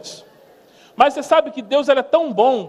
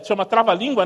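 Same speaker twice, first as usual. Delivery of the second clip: normal talking voice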